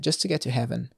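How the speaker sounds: ordinary delivery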